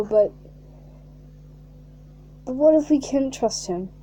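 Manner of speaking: ordinary delivery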